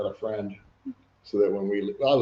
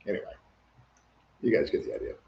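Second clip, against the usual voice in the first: usual speaking voice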